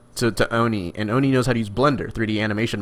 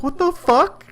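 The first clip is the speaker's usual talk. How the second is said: mock shock; slower than usual. Retold shocked stranger.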